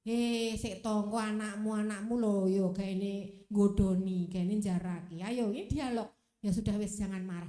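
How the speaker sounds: low inner voice of reason; low-pitched, restraining self-talk; voice of thought. Acted. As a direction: play the mind reasoning the feelings down, pitched low. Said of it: "pikiran kita ngomong".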